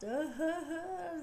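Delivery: high, wailing mock sob; mock crying. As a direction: burst into a hammed-up boo-hoo. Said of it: "start crying".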